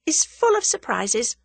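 high, lightly sententious; moralizing. A narrator's reading. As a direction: pronounce it like a wise maxim. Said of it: "moralized Tuppence".

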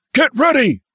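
high, loud barked command; barked order. A narrator's reading. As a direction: bark it at the men. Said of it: "barked Sergeant Willits".

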